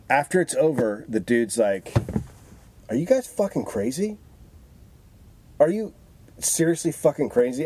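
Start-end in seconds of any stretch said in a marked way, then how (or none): 2.9-7.6 higher, disbelieving mock outrage; incredulous outrage. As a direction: imitate the stunned victim asking if they're insane. Victim's outraged reaction after prank.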